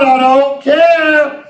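I don't care stretched long and slow; slow, firm I don't care; slow drawn-out declaration. Shown as a demonstration. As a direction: say I don't care like you mean it. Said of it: "Some of y'all need to say".

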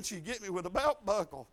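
ordinary delivery

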